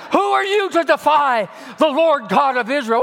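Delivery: put-on defiant young hero; defiant challenge. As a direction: call out the giant boldly. David defies the giant.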